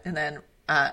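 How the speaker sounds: ordinary talk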